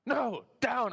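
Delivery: high, insistent mock commands; imitated guard's orders. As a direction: order someone about like an exasperated guard. Imitated guard correcting him.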